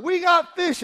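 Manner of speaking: acted ecstatic fisherman's cry; excited shout. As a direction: holler to the other boat at full voice. Peter yells to the other boat.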